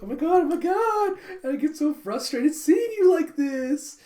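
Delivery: high, loud dramatic gushing; gushing rich girl. Acted. Becky gushing to Anya, high loud girly voice. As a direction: swoon over her friend's woes.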